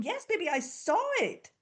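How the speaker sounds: put-on high, bright praise for a child; mother praising her child's cartwheel; fond mother. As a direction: cheer the little one on. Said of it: "I'm sitting here going".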